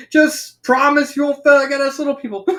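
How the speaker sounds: high, loud cartoon slacker; Shaggy. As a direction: pitch it up like a goofy cartoon pal. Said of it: "Shaggy lets out".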